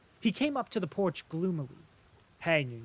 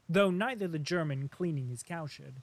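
ordinary delivery, nothing marked

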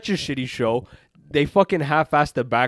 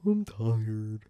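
softer, mopey mock complaint; mock whining; 3 semitones lower, 6 dB quieter than usual. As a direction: mumble it like a sulky elephant prince. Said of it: "he would just fucking complain".

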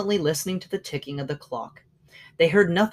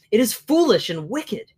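usual speaking voice

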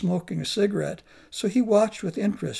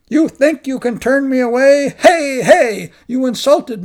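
loud, higher and indignant; insulted shout; 6 semitones higher, 11 dB louder than usual. Acted. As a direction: yell back in outrage. Insulted monk shouting back.

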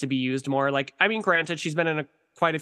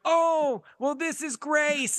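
put-on high, slow old lady voice; old lady character; 12 semitones higher, much slower than usual. Sisters doing a phone bit, high slow old lady voice. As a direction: quaver it out like a confused granny. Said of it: "my sister will be like".

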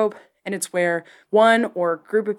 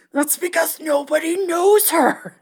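higher, slow teasing husband voice; mock husband; 9 semitones higher than usual. Husband teasing his wife in a put-on voice. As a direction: ham up the husband's dry jab. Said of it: "her husband's like".

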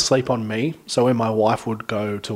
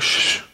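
shushing. Retold or imitated. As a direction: hush him to keep the baby asleep. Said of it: "I was like".

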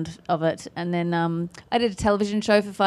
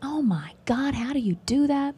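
breathless, higher whispered awe; amazed whisper; 6 semitones higher than usual. Retold or imitated. Fans amazed by her accent, whispered awe. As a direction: gasp it like a fan who's stunned by the accent. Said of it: "They always are just like".

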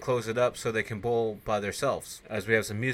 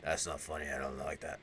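dropped low and quiet, sulky; grumbling man annoyed at a prank; low grumpy voice; 7 semitones lower, 9 dB quieter than usual. Retold or imitated. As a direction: growl it under the breath at the prankster. Angry Bosworth after prank.

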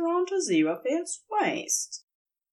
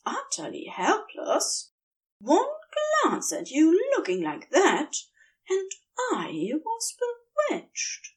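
a lightly voiced, higher playful angel; teasing angel; 9 semitones higher than usual. A narrator's reading. Angel flattering his sulking demon lover, lightly voiced higher tease. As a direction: tease him with mock helplessness.

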